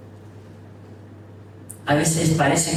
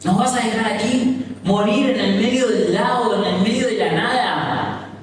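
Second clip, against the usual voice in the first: reproche angustiado; 6 semitones higher than usual. Acted. Pedro reprocha a Jesús.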